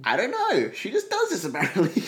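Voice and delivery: loud, very high exasperation; exasperated outburst. Real, not acted. Asked why mum lied, real exasperated outburst. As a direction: burst out laughing in high-pitched bafflement.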